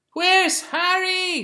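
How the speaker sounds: high, loud, slow shouting together; drawn-out group shout; crowd shout. A narrator's reading. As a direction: yell it like a whole beach crowd. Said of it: "shouted all the people".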